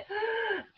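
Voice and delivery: a sharp, high gasp as if choking; imitated startled gasp. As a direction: gasp in sudden shock, high in pitch. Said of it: "I went".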